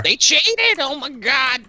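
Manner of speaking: outraged viewer. Pretend.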